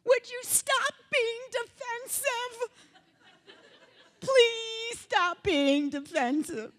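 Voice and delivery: high, slow, drawn-out begging; exasperated plea. Pretend. Speaker to the audience about arguing, exasperated plea. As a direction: plead in a stretched, high, mock-desperate voice.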